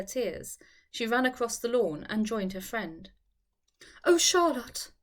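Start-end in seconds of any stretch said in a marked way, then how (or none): 4.0-4.9 out of breath, high and urgent; breathless. A narrator's reading. Eleanor out of breath, calling to her friend Charlotte. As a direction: gasp her friend's name out. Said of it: "almost too much out of breath to speak very plainly".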